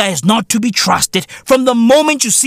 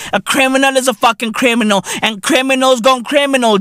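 ordinary delivery

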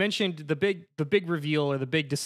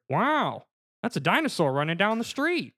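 higher-pitched, swooping movie-star impression; Owen Wilson; 6 semitones higher than usual. [name] spotting a dinosaur through binoculars, Owen Wilson impression. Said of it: "show off your Owen Wilson voice".

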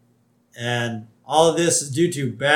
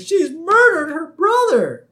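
high, loud, drawn-out mock horror; Maude Flanders; 7 dB louder, slower than usual. Neighbor accusing the girl before the crowd, high hammed-up horror. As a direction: shriek the accusation slowly like a cartoon busybody. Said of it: "Maude Flanders is there".

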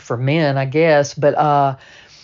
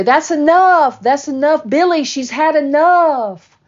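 high, loud desperate pleading; begging him to stop through the door; mother screaming for him to stop; 12 semitones higher, 5 dB louder than usual. Retold or imitated.

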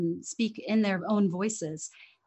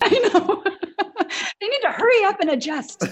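much higher and louder, laughing through the words; 12 semitones higher, 7 dB louder than usual. Real, not acted. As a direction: laugh along in full agreement. Laughing agreement with guest.

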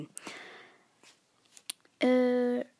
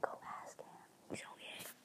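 whispered and much softer, off mic; softly telling her brother off mic to go ask; whispered aside; 18 dB quieter, faster than usual. Real, not acted. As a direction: whisper quickly to someone in the room.

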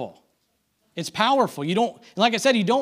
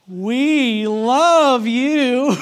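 loud, high, very drawn-out mock adoration; hammed-up sarcastic chorus, stretched slowly; 7 semitones higher, 10 dB louder, much slower than usual. Hotel staff obeying the movie voice, sarcastic. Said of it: "it's sarcastic".

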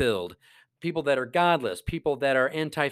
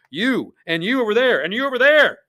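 1 calling out; 8 semitones higher, 9 dB louder than usual. Real, not acted. Points out viewers one by one.

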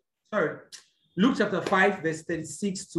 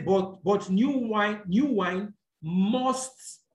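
must leaned on slowly, slower than the rest; stress on must; much slower than usual. Shown as a demonstration. Preacher to congregation reading Luke, slow stress on must. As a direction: drag out new wine and land hard on must. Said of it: "emphasis on the word must".

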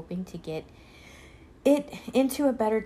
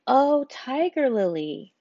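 addressing a flower; 6 semitones higher, 6 dB louder, slower than usual. Acted.

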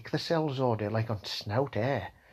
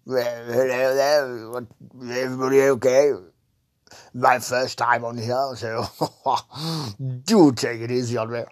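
posh Frank; 7 dB louder than usual. Acted. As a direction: greet them grandly as a plummy toff. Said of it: "old posh fucking Frank here".

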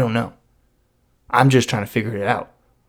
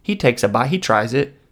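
ordinary delivery

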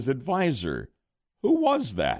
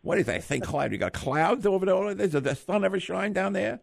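Mike Tyson voice; 3 semitones higher than usual. Acted. Host as the boxer greeting the winning caller, little teeny voice. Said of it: "finally recognized my voice".

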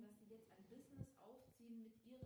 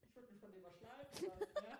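laughing; 5 semitones higher, 5 dB louder than usual. Real, not acted. Giggling about being ground.

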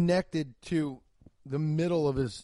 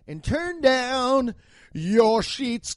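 high, loud and slow, a hammed-up come-on; mock seductive; 8 semitones higher, 6 dB louder, much slower than usual. Talking to listeners going to bed, mock seductive. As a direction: stretch it out like a cheesy lover. Crooning a bedtime send-off.

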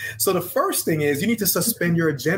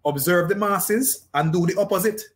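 higher, slow Jamaican patois lilt; slow Jamaican father's voice, pitched up; dad's Jamaican accent; 4 semitones higher than usual. Retold or imitated. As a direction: quote Dad in his thick Jamaican accent. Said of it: "I quote my dad in his accent".

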